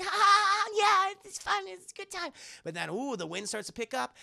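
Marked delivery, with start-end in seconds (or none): 0.0-2.3 put-on high, airy little-girl voice laughing; girly giggle. Narrator voicing little Yukina, high giggly girl voice. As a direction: voice a sweet little girl playing in the snow.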